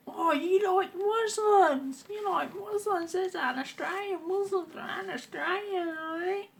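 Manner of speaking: very high, slow, sneering parody voice; sarcastic mock bigot. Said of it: "Yeah, that's sarcasm".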